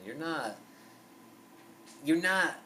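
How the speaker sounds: normal talking voice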